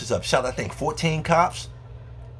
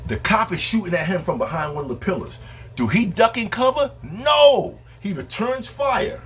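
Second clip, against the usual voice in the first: much higher, animated sportscaster-style blow-by-blow; excited play-by-play; 11 semitones higher than usual. Acted. Comic describing fight footage to the audience, excited play-by-play. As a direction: call the action like a live commentator.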